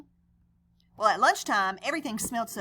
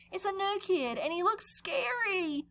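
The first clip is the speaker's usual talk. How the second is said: frightened cry; 8 semitones higher, 4 dB quieter than usual. Acted. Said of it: "cried Joey Mackerel".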